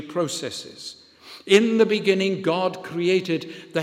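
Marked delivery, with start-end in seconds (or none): 1.5-2.4 each word of the refrain landed firmly; emphatic refrain. Acted. Preacher driving home the refrain, a little louder. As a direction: declare it like a creed. Refrain repeated with rising force.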